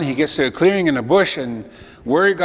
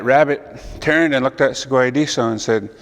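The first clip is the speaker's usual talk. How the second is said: ordinary delivery, nothing marked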